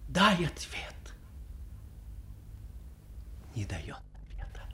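dramatic recital, swelling loud and dropping to hushed phrases; impassioned literary recitation. A narrator's reading. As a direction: recite with sweeping, changeable intensity. (вдохновенная художественная декламация с перепадами от громкого к тихому)

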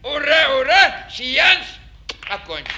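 громко, торжественно, как в цирке; зычно закрой представление; booming circus ringmaster. Acted. Конферансье громко закрывает сеанс. (громогласный цирковой конферансье)

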